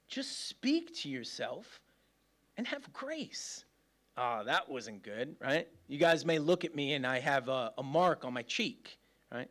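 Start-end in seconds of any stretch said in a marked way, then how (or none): 0.1-3.6 soft, slow, acted divine advice; gentle counsel. The Lord telling him how to speak to himself, softly and gently. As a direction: counsel softly, leaving room around the words. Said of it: "The Lord's telling me".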